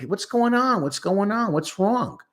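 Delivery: high, bewildered "What's going on?"; puzzled onlookers. Retold or imitated. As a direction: sound baffled by someone's odd behaviour.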